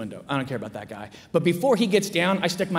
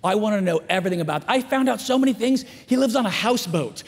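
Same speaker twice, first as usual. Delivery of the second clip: usual speaking voice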